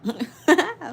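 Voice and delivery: sudden loud laugh at the screen; loud burst of laughter. Real, not acted. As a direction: cracks up loudly.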